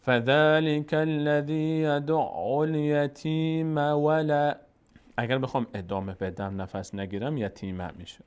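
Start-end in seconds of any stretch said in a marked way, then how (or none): 0.0-4.5 slow chanted recitation running الیتیم straight on into ولا; Quranic recitation continuing. Shown as a demonstration. Teacher recites the verse, linking it to the next word. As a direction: recite slowly without stopping at الیتیم.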